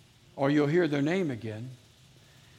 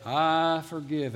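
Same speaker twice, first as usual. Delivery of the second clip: slow, drawn-out declaration at full voice; declared aloud; much slower than usual. Shown as a demonstration. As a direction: lead a congregation in a spoken vow. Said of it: "say it with your mouth".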